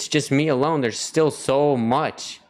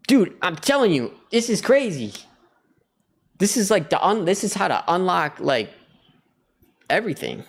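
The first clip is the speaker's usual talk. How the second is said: high, emphatic amazement; excited revelation; 6 semitones higher than usual. Real, not acted. Telling viewers this is how to unlock everything, thrilled. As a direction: insist on it, pitched well up. Excited at his own discovery.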